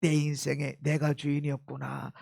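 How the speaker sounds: low, soft, inward realisation; confession. Acted. Believer confessing before God who owns their life, confessing softly. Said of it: "나올 때마다 뭘 고백하는".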